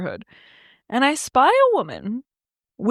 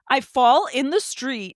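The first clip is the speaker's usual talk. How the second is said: ordinary talk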